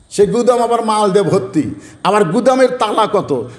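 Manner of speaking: ordinary talk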